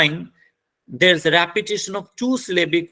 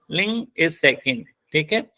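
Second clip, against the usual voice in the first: ordinary talk, nothing marked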